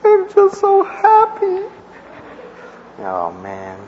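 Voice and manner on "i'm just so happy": high sobbing falsetto, wailed out; wife crying. Retold or imitated. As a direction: imitate the wife sobbing happily in a high wail. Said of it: "And she goes".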